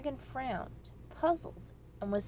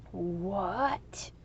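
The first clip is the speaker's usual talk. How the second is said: flabbergasted; 2 semitones lower than usual. A narrator's reading. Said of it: "cried the dragon, flabbergasted".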